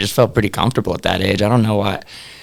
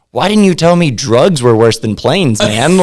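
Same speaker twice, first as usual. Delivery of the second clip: mock outrage; 4 semitones higher, 6 dB louder than usual. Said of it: "It's like".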